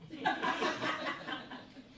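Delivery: breathy laugh through tears. Real, not acted. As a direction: let out a short airy laugh. Laughs at own remark in eulogy.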